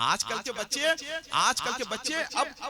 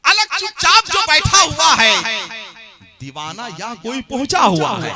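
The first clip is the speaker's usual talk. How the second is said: ringing recitation, much louder; loud poetic declamation; 4 semitones higher, 16 dB louder than usual. Acted. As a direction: proclaim each line to the hall. Couplet delivered loud. (ज़ोरदार अंदाज़ में शेर पढ़ना)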